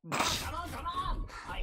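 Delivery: high-pitched giggling laugh; amused laugh. Real, not acted. Reacting to a teammate's gold elims claim, high laughter.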